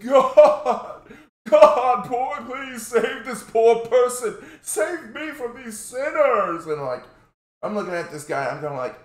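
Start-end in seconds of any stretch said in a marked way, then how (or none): 0.1-6.7 high, slow mock wailing prayer; imitated crying plea. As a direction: ham up a sobbing plea to God, much higher. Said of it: "And he starts crying".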